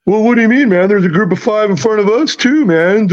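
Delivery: imitating a defensive golfer, pitched high; mock golfer voice. Golfer retort in retold fight.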